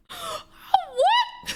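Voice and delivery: high, squeaky laughing "what?"; incredulous laughter. Retold or imitated. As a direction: crack up in disbelief. Laughing in disbelief at the insult.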